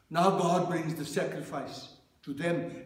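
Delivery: ordinary delivery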